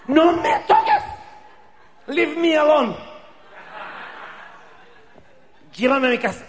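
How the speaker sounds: very high, loud falsetto shriek; hysterical screech. Acted. Hysterical girlfriend screaming at her boyfriend to leave her alone.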